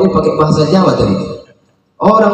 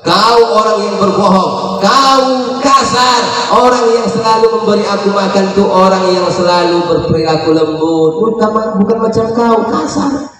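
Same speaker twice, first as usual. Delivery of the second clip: put-on scolding old woman; 4 semitones higher than usual. Blind old woman scolding Abu Bakar, high nagging crone voice. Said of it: "dibentak-bentak Abu Bakar".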